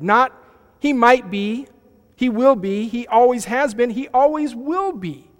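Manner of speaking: loud, high, building preacher's cry; preaching at full force. Real, not acted. Pastor driving home to the church, loud and high. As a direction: lift the congregation with rising fervour.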